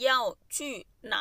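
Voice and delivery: stretched out, very slow, for learners; drawn out word by word like a teacher. Shown as a demonstration. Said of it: "slow drill of phrase". (พูดช้ามากแบบครูสอน)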